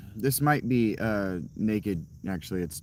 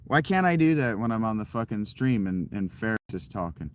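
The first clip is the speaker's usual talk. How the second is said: usual speaking voice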